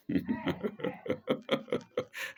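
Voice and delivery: soft run of breathy chuckles. Real, not acted. Lecturer pauses his reading, real light chuckle.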